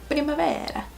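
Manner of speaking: playful Italian-accented Primavera. Shown as a demonstration. Vlogger naming her eyeshadow shade, in an Italian accent. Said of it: "say that in an Italian accent".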